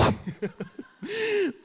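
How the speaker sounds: high burst of laughter. Real, not acted. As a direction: laugh at your own joke. Laughs at own example.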